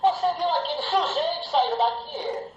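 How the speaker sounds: "Você viu aquele sujeito sair daqui?" with "sujeito" stressed. Shown as a demonstration. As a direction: hit the word "sujeito". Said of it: "mudar a palavra tônica em uma frase".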